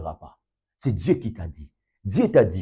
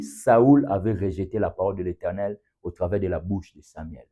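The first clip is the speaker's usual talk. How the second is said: ordinary talk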